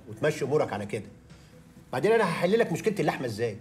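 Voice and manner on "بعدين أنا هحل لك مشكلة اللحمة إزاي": higher-pitched, indignant rhetorical questions; exasperated boss. Acted. Boss rebuffing worker's complaint about meat prices, exasperated. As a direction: build in pitch to an incredulous question.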